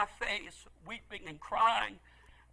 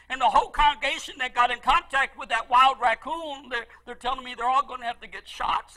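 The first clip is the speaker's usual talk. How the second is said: normal talking voice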